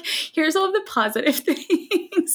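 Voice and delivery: laughing. Pretend. Laughing while imagining infographic.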